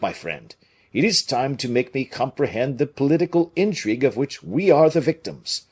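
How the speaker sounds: earnest demand; Porthos voice. A narrator's reading.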